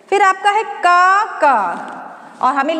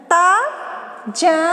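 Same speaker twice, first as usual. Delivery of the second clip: slowly sounded out; much slower than usual. Shown as a demonstration. Teacher slowly reading तजन to students.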